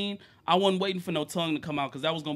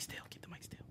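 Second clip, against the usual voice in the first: whispered, much softer reminder; whispered aside; 27 dB quieter than usual. Real, not acted. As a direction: whisper it off to the side. Quietly telling guest about mic.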